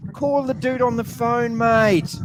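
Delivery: a touch of mock exasperation, drawn out; old-school host telling his young producer what to do, lightly exasperated; exasperated instruction. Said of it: "I keep saying to my young producer".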